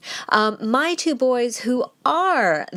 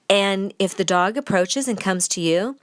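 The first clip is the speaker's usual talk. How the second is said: ordinary talk, nothing marked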